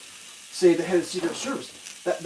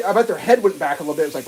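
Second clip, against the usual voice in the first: ordinary delivery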